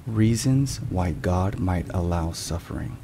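ordinary talk, nothing marked

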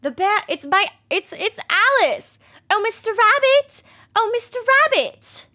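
put-on high, loud girlish Alice; squeaky Alice voice.

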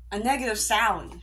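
ordinary talk

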